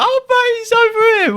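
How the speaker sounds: very high, gleeful call-out; retold high-pitched squeal of excitement; excited discovery. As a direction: imitate calling your mates over in delight. Spotting naked friend on monitor.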